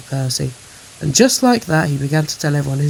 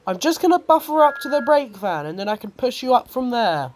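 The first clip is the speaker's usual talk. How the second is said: higher-pitched eager engine voice; a little engine offering a push; high, reassuring train character; helpful Thomas; 9 semitones higher than usual. A narrator's reading.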